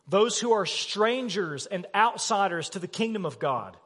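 ordinary talk

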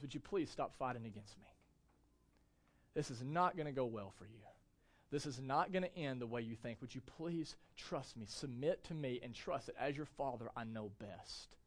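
put-on God gently begging his children to stop fighting; tender plea. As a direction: coax them home quietly. God pleading with rebels.

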